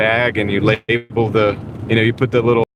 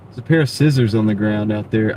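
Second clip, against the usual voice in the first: usual speaking voice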